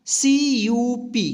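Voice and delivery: letters spelled out slowly; slow spelling. Shown as a demonstration. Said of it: "क्या होगा?". (धीरे-धीरे spelling)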